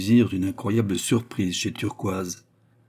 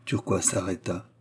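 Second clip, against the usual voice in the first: plain reading voice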